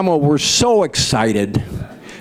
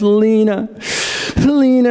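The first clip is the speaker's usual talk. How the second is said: calls her name louder and higher, insisting; insistent louder call; 7 semitones higher than usual. Acted. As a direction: wakes her with a sharp call. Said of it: "tapped her really hard".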